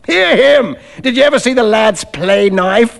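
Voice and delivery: high, animated rogue's jeer; loose, over-familiar mockery; coarse stranger, mocking. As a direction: taunt him in front of company.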